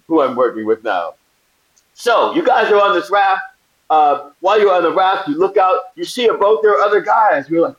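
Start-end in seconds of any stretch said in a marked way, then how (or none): 1.9-7.5 high, eager briefing voice; producer voice. Retold or imitated. Crew guy briefing actors on the raft, high and eager. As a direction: pitch it up like an excited crew guy laying out the plan. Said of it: "They go".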